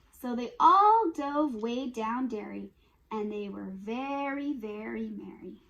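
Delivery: bouncy, sing-song picture book reading; reading to children. Acted. Parent reading muddy piggy book to kids, sing-song and lively. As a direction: read it like a lively bedtime rhyme.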